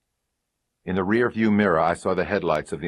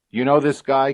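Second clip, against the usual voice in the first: higher, louder pointed cop question; sharp question; 10 semitones higher, 7 dB louder than usual. Acted. Sergeant to the wife, sharp loud question. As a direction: press her for the truth.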